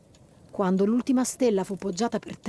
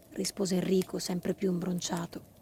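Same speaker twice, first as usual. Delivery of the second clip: plain reading voice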